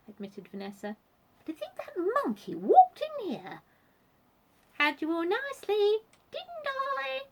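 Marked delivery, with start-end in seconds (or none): 1.4-3.6 high, loud genteel complaint; indignant Vanessa. A narrator's reading. 4.7-7.2 high, loud taunting squeak; squeaky monkey. A narrator's reading. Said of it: "squeaked a voice down the chimney".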